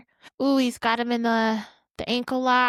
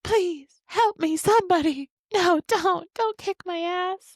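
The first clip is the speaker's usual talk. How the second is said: mock begging Ric Flair; 7 semitones higher than usual. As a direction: beg like a cowardly heel. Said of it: "He's like".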